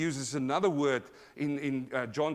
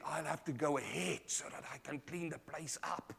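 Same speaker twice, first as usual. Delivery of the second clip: retold whisper; 11 dB quieter than usual. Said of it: "the wife whispered in his ear".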